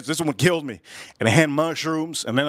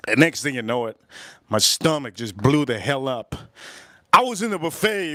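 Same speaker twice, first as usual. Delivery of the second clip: ordinary talk, nothing marked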